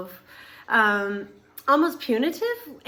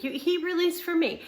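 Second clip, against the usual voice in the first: usual speaking voice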